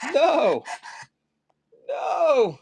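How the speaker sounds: mock wail. Real, not acted. Streamer mock-wails over broken horn. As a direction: wail it like a tragedy.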